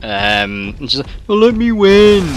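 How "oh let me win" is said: high-pitched mock Birmingham woman; badly faked Birmingham accent, pleading; attempted Birmingham accent. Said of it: "I'm trying to do Birmingham accent".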